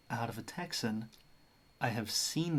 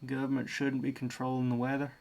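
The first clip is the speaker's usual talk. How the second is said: brisk line in a put-on Southern accent; generic Southern accent; much faster than usual. Repeating someone's weather complaint, in a quick generic Southern accent. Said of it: "like, generic South".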